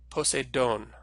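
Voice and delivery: Poseidon said po-say-DOHN; Greek pronunciation. Shown as a demonstration. Teacher modelling a Greek word for students, Poseidon as po-say-DOHN. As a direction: stress the long final syllable of Poseidon, "DOHN". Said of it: "let's try pronouncing this word".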